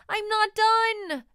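put-on high, loud, slow child's protest; a child cries out that she isn't finished; crying out. A narrator's reading. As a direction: wail it like an upset little girl. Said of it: "cries Amy".

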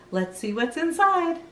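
usual speaking voice

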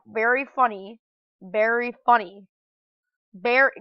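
loud, exasperated sarcastic repeat; fed up with the game, say it loudly; real irritated sarcasm. Real, not acted. Sarcastic reaction to game tricking him.

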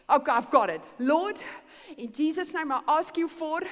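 bold prayer. Acted. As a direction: call out to God with confidence. Acts out bold prayer request.

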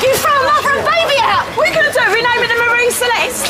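a touch of outrage, voice up; angry protest. Acted. Woman protesting at the landlord throwing them out.

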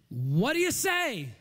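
slow, stretched call swooping high; challenging call. Real, not acted. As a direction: throw it out slowly to the whole crowd. Challenges congregation to answer.

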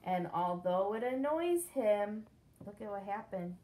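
plain reading voice

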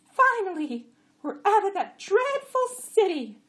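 high, loud donkey voice; braying jenny. A narrator's reading. Jenny to the two jacks behind her, braying high.

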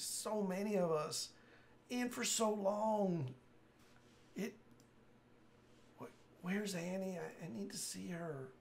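urgent plea with last breath; dying man voice. Acted. Dying NPC continues.

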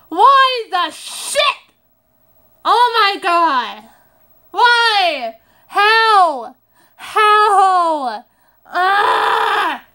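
loud, high outraged yells; furious at a thousand-dollar bill, yelling; exasperated shouting. Real, not acted. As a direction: rant at the bill, voice climbing. Shocked by huge bills.

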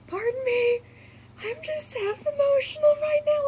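very high, mock-weepy squeal; happy tears. Fan excusing herself to friend after hug, tearfully emotional. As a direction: squeal it as if about to cry happily. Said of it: "some tears forming, like, all happy tears".

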